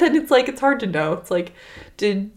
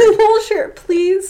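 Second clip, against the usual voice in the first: begging; 9 semitones higher, 5 dB louder, slower than usual. Acted. Begging for blankets.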